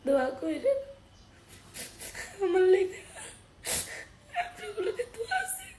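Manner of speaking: spent, weeping plea; tearful. Real, not acted. Asking viewers to pray for them, crying. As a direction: push words out between sobs.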